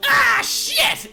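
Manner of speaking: loud high goblin shout of frustration; cursing goblin. Acted. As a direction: spit out the curse as you lose. Losing goblin curses.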